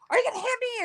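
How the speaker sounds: high-pitched mock challenge; squeaky-high retold question; mimicked ex. Voices ex's challenge in retelling.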